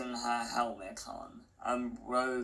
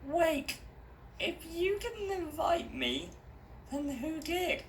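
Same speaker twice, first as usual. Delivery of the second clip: put-on much higher, unsure girl's voice; young girl Stella. A narrator's reading. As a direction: sound like the hesitant heroine.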